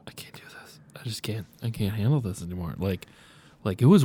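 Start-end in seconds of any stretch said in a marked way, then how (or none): 0.1-2.8 shaken, breathless whisper of a broken man; falling apart, barely able to speak; panicked whisper. Acted. Traumatized character breaking down.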